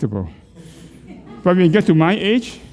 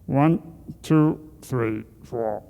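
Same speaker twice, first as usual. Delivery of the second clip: strained counting; 5 semitones lower, 6 dB quieter, much slower than usual. Real, not acted. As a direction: lower and much softer, squeezed by the chin tuck. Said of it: "I can't even talk".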